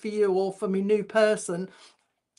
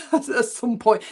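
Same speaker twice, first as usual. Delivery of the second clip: laughing; 2 semitones higher, 3 dB louder, slower than usual. Real, not acted. Laughs at the spirit's joke.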